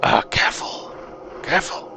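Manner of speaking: secretive, half-whispered dwarf; dwarf's hushed warning. Acted. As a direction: warn them under your breath, in character. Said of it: "he's gonna say".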